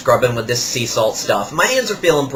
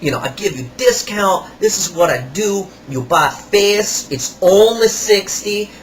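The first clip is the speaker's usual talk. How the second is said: higher, slow put-on salesman voice; imitating the salesman; 5 semitones higher, slower than usual. Kiosk salesman rubbing salt on a customer's hands, imitated higher and slower. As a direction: ham up the pushy kiosk seller. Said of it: "He goes".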